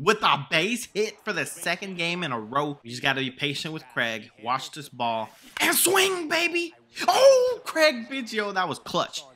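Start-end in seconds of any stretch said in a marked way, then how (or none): 5.6-8.5 high, loud celebrating yell with a laugh; excited whoop. Real, not acted. Gamer celebrating a clutch hit, high excited whoop. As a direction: whoop as the ball flies.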